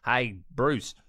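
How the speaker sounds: loud, matey Australian greeting and questions; Australian accent. Acted. Interviewer quizzing the late Steve Irwin, Australian accent. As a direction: hail him in an Australian accent. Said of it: "why am I doing a bloody Australian accent".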